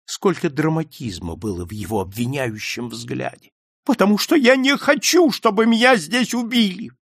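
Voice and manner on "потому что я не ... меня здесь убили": high, loud frightened outcry; desperate accusation. A narrator's reading. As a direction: cry out in fear of being killed. Swede accuses them dramatically. (отчаянный обвиняющий крик)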